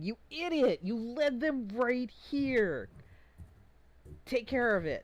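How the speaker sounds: loud, high roar of rebuke; furious acted yell at a bungling underling; angry yelling. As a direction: bellow it, drawing the words out. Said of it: "that is yelling".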